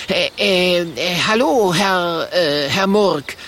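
nervous halting stammer; stuttering. A narrator's reading. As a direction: stammer it out with pauses. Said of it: "stottert Kokosnuss".

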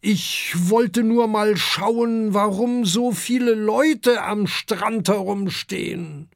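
high, slow, sheepish giant; Amadeus stammering. A narrator's reading. Sea dragon Amadeus sheepishly explains himself to Kokosnuss, slow and awkward. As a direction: excuse yourself awkwardly. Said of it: "stottert Amadeus".

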